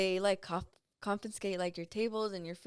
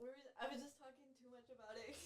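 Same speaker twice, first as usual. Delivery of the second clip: very soft, rushed, higher tearful voice; really crying; 4 semitones higher, 22 dB quieter, faster than usual. Real, not acted. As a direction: hurry it out quietly through tears. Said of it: "crying about it right now".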